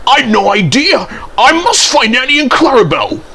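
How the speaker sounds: high-pitched, hurried excuses; flustered exclaiming. A narrator's reading. Thomas excuses himself from the shed, high and flustered. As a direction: make flustered excuses to get away. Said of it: "exclaimed Thomas".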